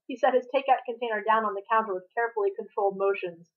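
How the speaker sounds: plain reading voice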